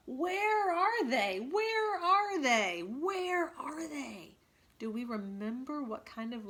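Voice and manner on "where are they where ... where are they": high, loud, slow cry, each word drawn out; savage echoing cry. A narrator's reading. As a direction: call out like the mountains themselves are hunting. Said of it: "the echoes seemed to cry savagely".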